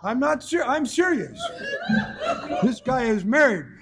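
amused laughing disbelief. Real, not acted. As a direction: giggle while insisting you're serious. Laughing at own story.

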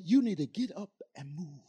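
soft, low retold command; quiet, weighty instruction from God; hushed voice of God.

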